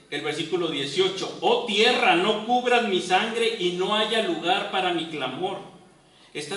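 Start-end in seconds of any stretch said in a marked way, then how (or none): 1.4-5.6 higher and louder, crying out to the earth; anguished plea. Acted. Job crying out to the earth, louder and higher. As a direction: call out for justice. Reading Job's cry to the earth.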